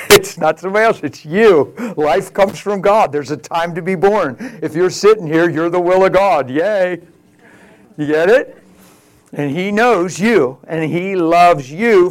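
loud, insistent sermon delivery; rousing preacher in full flow; emphatic preaching. Real, not acted. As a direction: drive each phrase home to the congregation.

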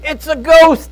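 high, loud frightened cry; cried out in fear. A narrator's reading. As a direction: cry it out like scared fishermen. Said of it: "they cried out in fear".

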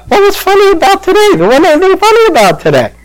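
very high, rapid squeal of disbelief; acted bewildered protest. Preacher replaying his baffled thoughts, high mock-frantic protest.

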